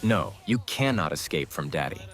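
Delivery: usual speaking voice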